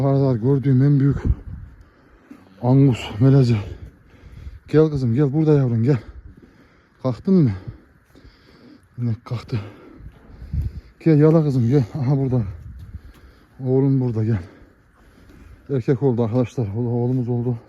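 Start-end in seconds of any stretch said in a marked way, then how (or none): 4.7-7.5 loud, high call to the cow; shouting encouragement to a new mother cow. Real, not acted. Calling the cow to calf. 11.0-14.4 loud, high call to the cow; shouting encouragement to a new mother cow. Real, not acted. Calling the cow to calf.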